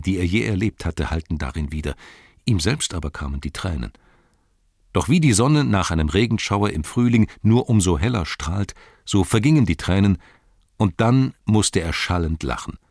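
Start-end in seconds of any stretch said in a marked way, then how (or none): none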